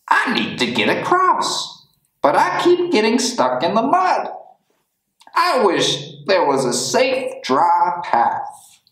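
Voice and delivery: high, fast little dog voice; a fretful dog stuck in mud; worried dog voice. A narrator's reading.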